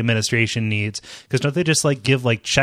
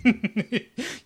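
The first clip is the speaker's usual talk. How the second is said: wheezy laugh; 9 semitones higher, 5 dB quieter than usual. Real, not acted. Helpless laughter.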